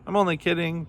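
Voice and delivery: high, teasing storybook line; chortled. A narrator's reading. Kangaroo teases stranded Camel, lightly chortled. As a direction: pitch it up in mock merriment. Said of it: "chortled Kangaroo".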